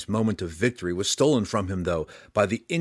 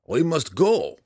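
higher, louder, urgent Burrow Warden voice; 7 semitones higher, 5 dB louder, slower than usual. A narrator's reading. Burrow Warden telling his friends to leave, urgent and raised. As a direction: press them to leave, pitched up and forceful. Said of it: "the Burrow Warden said at length".

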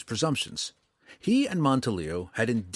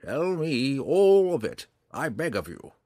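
somber; 5 semitones higher, 3 dB louder than usual. A narrator's reading. Old ranger begging Drizzt to tell his past, somber. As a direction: plead gravely, drawing out the words. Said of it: "Monteleo's voice was somber as he replied".

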